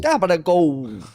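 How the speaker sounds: slow, stretched fake Italian accent; mock Italian. Comic echoing the joke about would-be Italians, mock Italian. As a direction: draw the word out in parody Italian. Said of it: "oh, go and speak Italian then".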